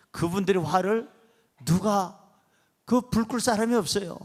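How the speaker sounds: ordinary delivery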